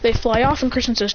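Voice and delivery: usual speaking voice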